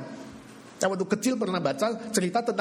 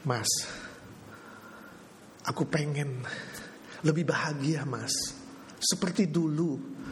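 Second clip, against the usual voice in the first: pleading wife; 6 semitones lower, 5 dB quieter than usual. Pretend. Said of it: "kamu bilang".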